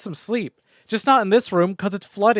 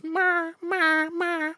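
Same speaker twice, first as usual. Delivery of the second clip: much higher mock dog meow; imitating the dog; 11 semitones higher, much slower than usual. Streamer imitating her mad dog, high mock meow. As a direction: squeak it out like the angry dog. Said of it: "She sounds like a cow when she gets mad".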